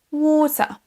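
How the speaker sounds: drawn-out British water; British accent. Shown as a demonstration. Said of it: "avec l'accent britannique".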